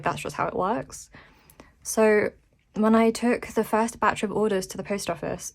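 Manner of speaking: usual speaking voice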